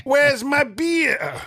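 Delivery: high, drawn-out comic holler without extra volume; slow, high-pitched mock plea; mock drink demand. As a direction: play-act calling for your booze.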